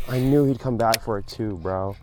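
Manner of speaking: ordinary talk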